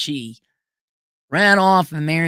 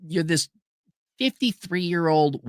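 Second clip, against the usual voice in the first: normal talking voice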